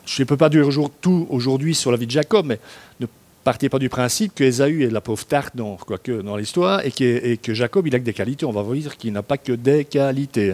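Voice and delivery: ordinary talk, nothing marked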